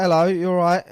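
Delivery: loud, high friendly hello; friendly shouted hello; calling out. Retold or imitated. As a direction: call out a cheery greeting across the way. Calling out to stranger.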